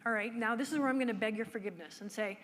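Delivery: ordinary talk, nothing marked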